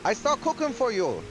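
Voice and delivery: broken English in a put-on Chinese accent; bad Chinese accent. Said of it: "the bad Chinese accent".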